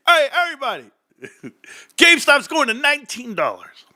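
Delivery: high-pitched mock hype; excited newcomer barging in. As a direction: burst in announcing big news to the room. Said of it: "comes running in".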